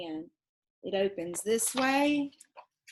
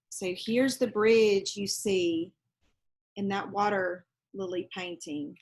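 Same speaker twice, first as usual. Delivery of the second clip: ordinary delivery, nothing marked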